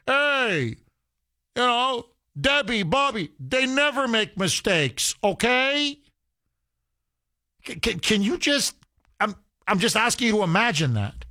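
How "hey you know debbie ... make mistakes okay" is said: high-pitched mock parental protest; lightly hammed-up offended mom; imitated indignant mother. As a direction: play a parent defending a child to the boss, pitched up. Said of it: "have your mother call the boss".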